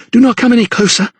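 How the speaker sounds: high, quick, frightened command; urgent high-pitched warning; panted warning. A narrator's reading. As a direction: fend someone off, breathless and high. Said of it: "she panted".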